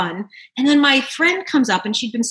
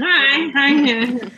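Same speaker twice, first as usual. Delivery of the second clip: loud, awkward mock greeting; 4 semitones higher, 4 dB louder than usual. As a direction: play the socially awkward greeter. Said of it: "just being sort of like".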